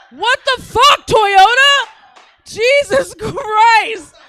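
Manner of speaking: high, loud, incredulous yelling; comic outrage at full volume; outraged shout. Real, not acted. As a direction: yell at the heckler in disbelief. Outraged at audience member Toyota.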